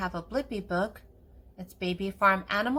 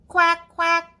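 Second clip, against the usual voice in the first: high, loud quacking; duck quack; 9 semitones higher, 10 dB louder than usual. Acted. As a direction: quack like a duckling. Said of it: "Waddle like a duckling".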